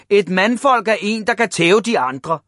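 high, loud definition; shouted lecture. A narrator's reading. Father explaining manhood to Ivan, shouting. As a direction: lay it down forcefully. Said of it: "råbte hans far".

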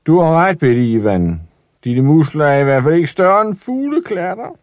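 put-on high teasing voice of big boys; mocking bullies. Big boys taunting little Ivan, high and mocking. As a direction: jeer at the small boy from up high.

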